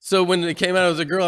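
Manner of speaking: normal talking voice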